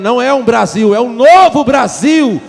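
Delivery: shouted, rising to a peak on "novo"; preacher's loud, insistent repeat; emphatic shout. Real, not acted. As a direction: drive it home to the congregation at full voice. Emphatic repeat after "não tá entendendo.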